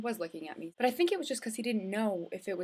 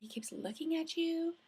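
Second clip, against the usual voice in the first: teasing friends; 9 semitones higher than usual. Retold or imitated. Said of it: "everyone was like".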